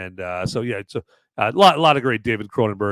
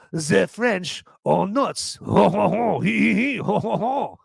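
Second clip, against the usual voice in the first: mock ho ho laugh; 8 semitones higher than usual.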